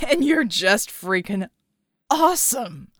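boozy gushing praise; slow, gushing drunk exclamation; drunk and excited. Acted. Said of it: "Red exclaimed".

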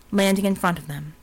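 usual speaking voice

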